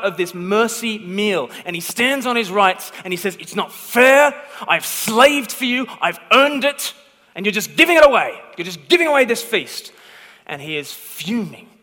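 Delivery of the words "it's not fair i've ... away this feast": loud, higher, indignant complaint; angry protest. Acted. Older son shouting his grievance at the father, loud and bitter. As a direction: rail at the father, hurt and furious.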